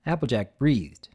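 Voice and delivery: usual speaking voice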